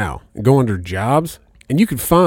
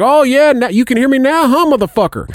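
imitating a much higher, louder sneering yell; retold furious yell; 12 semitones higher, 4 dB louder than usual. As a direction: shout it like a scammer who caught the trick. Scammer gloats angrily.

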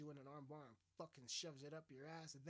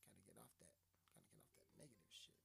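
low mutter; 8 semitones lower, 14 dB quieter, faster than usual. Real, not acted. Muttered low aside to himself.

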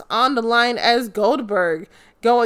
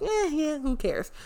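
bored, dismissive murmur; couldn't care less; soft, indifferent brush-off; bored shrug; 5 semitones higher, 8 dB quieter than usual. Retold or imitated. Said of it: "I was like".

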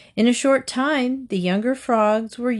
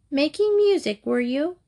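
"Making music" thrown back much higher, sneering; mocking cry; 6 semitones higher than usual. A narrator's reading. Ants mocking the grasshopper's excuse, high sneer. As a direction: mimic his excuse with scorn. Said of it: "they cried".

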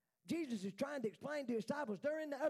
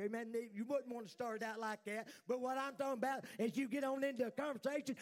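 ordinary talk, nothing marked

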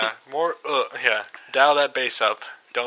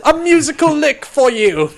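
high, loud courtly minstrel address; bow and hail the king grandly; 12 semitones higher, 7 dB louder than usual. Acted. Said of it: "playing for the king".

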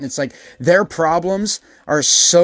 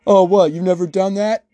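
higher, louder incredulous voice; lightly mocking tweaker surprise; mock surprised tweaker; 5 semitones higher, 4 dB louder than usual. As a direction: act amazed someone hasn't stolen. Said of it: "they're like".

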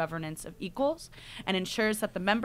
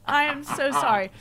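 laughing mock apology; 10 dB louder, much slower than usual. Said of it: "And I was like".

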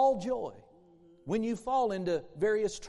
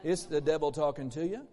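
ordinary talk, nothing marked

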